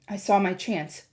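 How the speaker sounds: usual speaking voice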